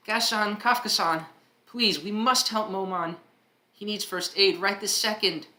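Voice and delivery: urgent plea. Acted.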